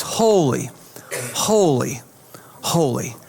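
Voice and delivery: low, hushed awe with long falling words; reverent refrain. Acted. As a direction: intone each word slowly, letting it settle. Lyrics of heaven's soundtrack.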